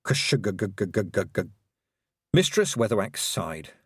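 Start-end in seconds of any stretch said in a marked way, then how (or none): 0.0-1.4 sputtering engine noise. A narrator's reading. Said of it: "The broomstick went".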